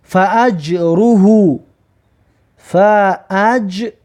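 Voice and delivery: فاجره drawn out very slowly; slow recitation. Shown as a demonstration. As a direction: stretch فاجره for students. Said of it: "ببینید".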